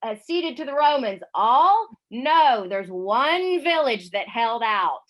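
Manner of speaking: slow, loud, grand narrator's voice building to a booming "All?"; dramatic comic-book narration. Acted. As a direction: read it like the epic opening of a comic. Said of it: "It says".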